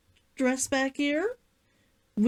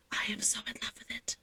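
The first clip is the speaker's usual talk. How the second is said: quick breathless whisper; 7 semitones lower, 12 dB quieter, much faster than usual. Real, not acted. Confiding her love of the costume, fast whisper.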